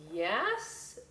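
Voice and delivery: ordinary delivery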